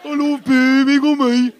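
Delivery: loud, high, slow sneering voice; mocking Birmingham bloke. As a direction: belt it like a sarcastic local. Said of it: "in your head went".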